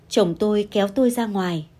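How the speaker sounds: ordinary delivery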